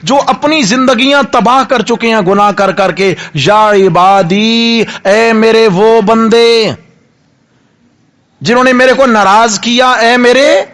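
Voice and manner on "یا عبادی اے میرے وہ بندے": slow, stretched call to the servants; drawn-out call. Acted. Preacher calling God's servants, slow and drawn out. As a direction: draw out "یا عبادی" like a recitation.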